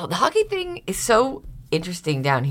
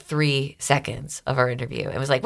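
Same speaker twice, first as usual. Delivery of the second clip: normal talking voice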